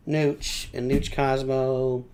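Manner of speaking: normal talking voice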